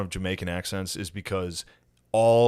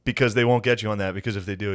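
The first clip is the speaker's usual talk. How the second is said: ordinary talk, nothing marked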